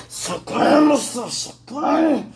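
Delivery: high, loud, slow tongues. Real, not acted.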